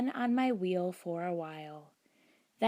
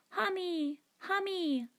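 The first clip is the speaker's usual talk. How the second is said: put-on high toddler voice calling out; little voice calling; 11 semitones higher than usual. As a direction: call it like a tiny child. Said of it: "a little voice called out".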